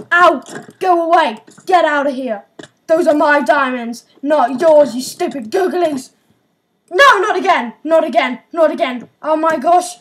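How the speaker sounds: loud, furious yelling at the mobs; angry shouting. Real, not acted. Player yelling at monsters taking his diamonds, furious. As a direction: shout them away in real anger.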